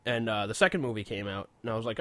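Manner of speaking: ordinary talk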